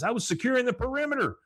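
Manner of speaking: put-on high, quick boy's protest; defensive kid. Bobby excuses himself to Hank, high and defensive. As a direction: pitch it up and rush the excuse.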